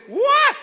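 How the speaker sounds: put-on sudden high loud baby cry; shrill imitated baby scream; baby wail. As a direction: burst out with a newborn's wail. Baby wakes parent crying.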